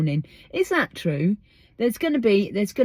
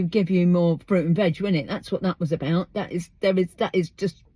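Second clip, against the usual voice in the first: ordinary talk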